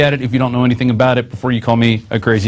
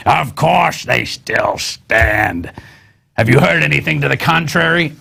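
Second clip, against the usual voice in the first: slow, drawn-out growly politician impersonation; slow mock-sinister retort; Dick Cheney; slower than usual. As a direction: put on a menacing vice president's voice, stretching the words. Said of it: "That was my Dick Cheney impersonation".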